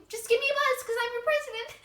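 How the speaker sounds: excited squeal. Acted. Gushing new president.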